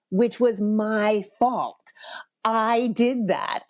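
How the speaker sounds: each word slowed and pressed for effect; slow, pointed lecture. Retold or imitated. Mom spelling out accountability to her eye-rolling son, slow and pointed. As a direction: drag it out like a parent making a point.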